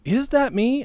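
high, puzzled question; surprised. Retold or imitated. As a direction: ask it in disbelief. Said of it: "I'm like".

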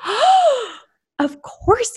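high gasped oh; surprised gasp. Retold or imitated. Spotting Kara's name in the cart, gasping high. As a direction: gasp it out in sudden recognition.

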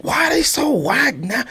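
ordinary delivery

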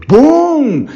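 a sudden booming crash noise, high and loud; shouted bang sound effect. Retold or imitated. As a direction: burst out with the crash of the bed. Bed collapsing under them.

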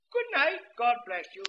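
put-on high, loud old man's farewell; a kindly old man blessing him; high old man saying good night. Old man voiced in story.

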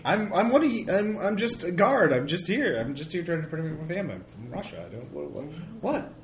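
a jittery guard pleading innocence. Acted. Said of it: "you're not channeling the voice of the G M".

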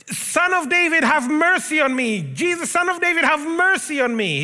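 high, loud, pleading cry for mercy; blind man crying out. Retold or imitated. Blind Bartimaeus shouting to Jesus over the crowd, high and loud pleading. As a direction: call out over the crowd, begging to be heard. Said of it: "he's shouting".

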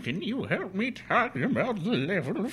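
put-on frail old man asking for help; creaky old-timer voice. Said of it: "assist a frail old man".

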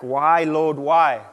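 loud, drawn-out cry to the Lord; mock-lamenting appeal; anguished plea. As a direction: cry out the question, acted. Acted anguished question to God.

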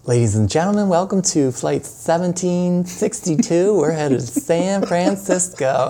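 a hint of an airline announcer, slowed down. Welcoming passengers aboard the San Francisco flight, mock announcer voice. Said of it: "did that voice".

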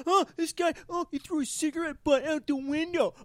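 imitating the snitch who called the hotline; mocking tattletale. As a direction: mimic the petty caller reporting him. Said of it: "to be like".

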